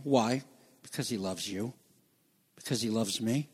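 soft, hushed and tender. Acted. Preacher telling the congregation God loves them, hushed. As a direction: speak it softly to each listener.

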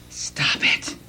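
breathy hush; whispered. Retold or imitated. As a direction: whisper it gently to calm them. Jesus calms the disciples.

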